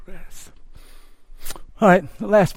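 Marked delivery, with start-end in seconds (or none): 0.1-0.5 hushed echo. Shown as a demonstration. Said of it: "Come to me".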